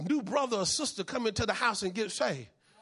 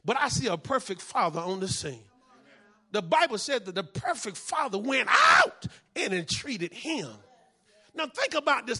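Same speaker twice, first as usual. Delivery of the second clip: ordinary talk, nothing marked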